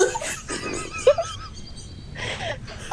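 long high-pitched laugh; hysterical laugh. Real, not acted. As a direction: break down laughing helplessly. Reader cracking up.

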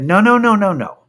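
high-pitched rapid-fire refusal, falling away at the end; retold alarmed objection; urgent objection. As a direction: stop them with a string of protests. Objecting to seat grabbers.

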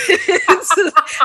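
loud, high laugh at own pick; burst of laughter. Real, not acted. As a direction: break into a big laugh.

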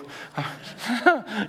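short disbelieving laugh; exasperated laugh. Real, not acted. Laughs at the situation.

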